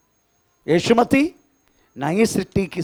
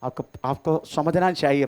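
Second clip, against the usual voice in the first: ordinary delivery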